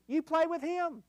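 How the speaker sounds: a high mock-sweet lilt; comic squeak. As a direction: pitch it way up for the laugh. Comic high voice on husband line.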